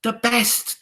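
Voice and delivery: high, loud eager chorus; shrill witch chorus crying out; witches crying out. Acted. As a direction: answer her like fawning hags. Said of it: "they cried".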